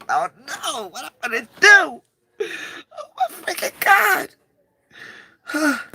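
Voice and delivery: squealing high, breaking with emotion; real happy near-tears squeal; overwhelmed. Real, not acted. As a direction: gush like you can't hold it in. Overwhelmed by a new book.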